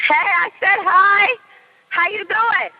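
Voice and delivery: high, drawn-out hello; calling a greeting. Real, not acted. Storyteller breaks off to greet someone nearby, calling high.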